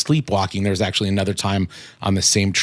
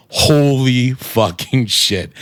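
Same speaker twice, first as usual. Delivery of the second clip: retold scream; 4 semitones higher, 5 dB louder, much slower than usual. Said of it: "started screaming".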